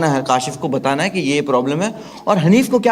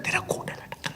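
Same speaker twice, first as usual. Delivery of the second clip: hushed whisper, much softer; 15 dB quieter than usual. Retold or imitated. Covertly hinting to Hanif about his pants, whisper. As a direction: breathe it as a secret hint.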